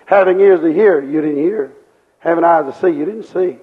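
ordinary talk, nothing marked

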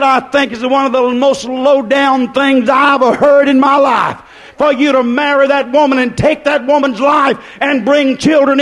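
preacher retelling how he scolded the couple; angry rebuke. Retold or imitated. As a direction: blast them for leaving the children.

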